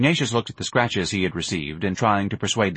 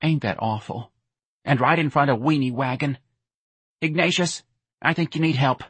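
mildly mournful mother voice; plaintive, higher-pitched concern; sad; 7 semitones higher than usual. Acted. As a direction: worry sadly over your son. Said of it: "Missus Riley said sadly".

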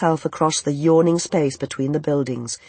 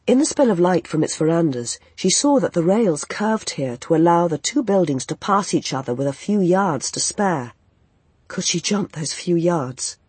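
usual speaking voice

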